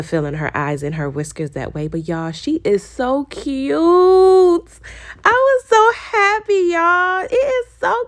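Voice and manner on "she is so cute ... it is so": high, slow, teary squeal of joy; joyful crying. Real, not acted. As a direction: near tears with delight over how cute it is. Overjoyed at the cute result.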